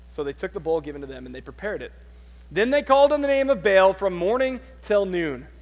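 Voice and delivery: ordinary talk, nothing marked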